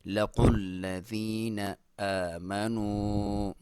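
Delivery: slow, drawn-out tajweed chant; slow Quran recitation. Shown as a demonstration. Teacher reciting the verse for students, slow and drawn out. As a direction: recite it slowly, stretching each vowel. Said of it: "लंबाई भी एक अलिफ के बराबर".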